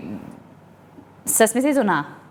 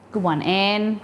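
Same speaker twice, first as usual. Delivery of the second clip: ordinary delivery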